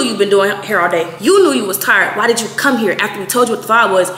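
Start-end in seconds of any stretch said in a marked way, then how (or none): none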